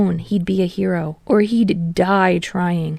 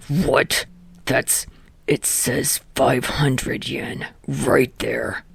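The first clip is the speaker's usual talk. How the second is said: sputtering; 6 semitones lower, 4 dB quieter, slower than usual. A narrator's reading. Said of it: "Katsuki sputtered".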